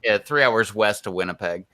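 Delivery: ordinary talk, nothing marked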